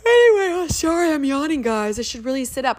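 high, bright imitation of a yawning podcaster; imitating podcaster. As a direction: play a chatty host apologizing for yawning. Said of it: "she'd be like".

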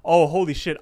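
sudden loud shock at a name; shocked exclamation. Real, not acted. Retelling a cartoon, suddenly startled and loud.

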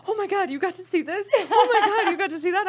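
high squealing excitement; excited gushing. Retold or imitated. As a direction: gush like an eager fan. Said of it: "she was like".

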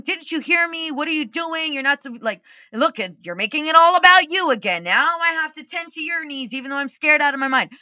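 imagined angry outburst at husband for being selfish; mock angry rant. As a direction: lash out at him in a hypothetical rant.

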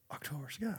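barely voiced realization; near whisper. Real, not acted. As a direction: breathe the title out softly. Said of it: "Wait, it's called".

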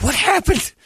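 high-pitched, exaggerated disbelief; mock alarm. Telling friends about a sudden sunburn, mock alarmed. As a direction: squeal it like a hammed-up panic. Acts out alarm at red skin.

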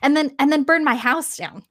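a touch of excited chatter; excited lords. Retold or imitated. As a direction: gush it like thrilled kings. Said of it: "they're really excited".

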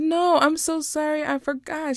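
high-pitched retold apology; earnest apology. Aunt apologising to her niece for forgetting, high and pleading.

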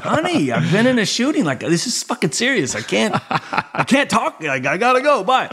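high-pitched, re-enacted flustered protest; exasperated pleading. Husband to wife during homicide walkthrough, mock-exasperated. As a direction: plead to get off the phone, half laughing.

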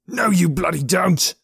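high, slow furious shout; angry growl. Acted. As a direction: snarl it out in anger. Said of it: "Norji growled".

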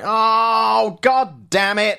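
high, loud mock exasperation; hammed-up "oh god damn it"; mock frustrated groan. Fan seeing the straight-to-DVD slate, loud mock despair. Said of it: "I was like".

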